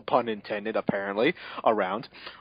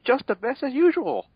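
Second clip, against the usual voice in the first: put-on much higher little-girl voice; girly Mal voice; 12 semitones higher than usual. Mal answering Roll in a much higher girly voice. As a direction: answer sweetly as a cartoon girl. Narrator voices Mal's reply.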